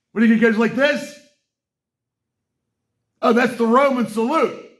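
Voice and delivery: incredulous mockery. Real, not acted. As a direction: scoff at the excuse. Mocking the denial.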